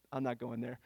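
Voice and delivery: low, quiet, laughing under breath; laughing aside. Real, not acted. Laughing aside about girlfriend.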